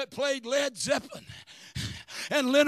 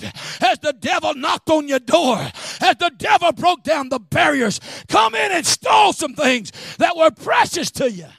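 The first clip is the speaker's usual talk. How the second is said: full-force preaching; 4 semitones higher, 12 dB louder than usual. Acted. Preacher warning the church about the devil, loud preaching. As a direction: hammer the warning home.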